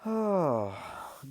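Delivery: long, loud, open exhale; heavy sigh before the next topic. Real, not acted. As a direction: let out a big audible sigh. Pauses, then moves on to gold.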